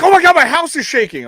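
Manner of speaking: high, loud mock panic; imitating panicked caller. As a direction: mimic a shaken friend on the phone. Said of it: "they were like".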